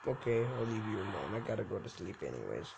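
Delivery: mock sleepy mumble; mumbled low voice. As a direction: drone it off. NPC voice going to bed.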